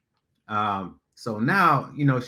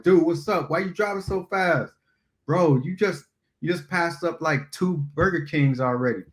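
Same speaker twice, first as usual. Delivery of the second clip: high, rattled complaint; she's clutching the door, voice jumping up; alarmed; 6 semitones higher than usual. Retold or imitated. Jill to speeding Phil, alarmed and high.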